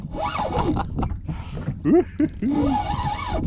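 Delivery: high excited laughter; delighted laugh. Real, not acted. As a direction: laugh with glee. Laughing at the bending rod.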